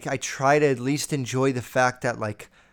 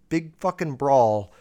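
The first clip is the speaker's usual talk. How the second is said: ordinary talk, nothing marked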